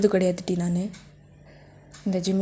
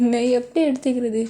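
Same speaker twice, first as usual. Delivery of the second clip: usual speaking voice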